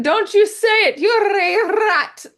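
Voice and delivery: high, loud, drawn-out villain snarl; hammed-up villain fury at being called a rat; Ratigan's rage. Fan acting out Ratigan's tantrum, hammed-up and loud.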